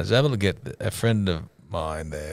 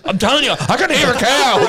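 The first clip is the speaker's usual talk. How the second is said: much higher and louder, pleading to be believed; insistent older lady; 7 dB louder than usual. Retold or imitated. Old lady insisting to the staff, high and loud. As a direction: squeak it out, sure of herself. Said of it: "She goes".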